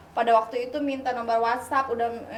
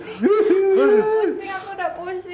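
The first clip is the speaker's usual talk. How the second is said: put-on drunk; 8 semitones higher, much slower than usual. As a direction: pretend drunk, laughing. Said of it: "Terus pura-pura mabok kan".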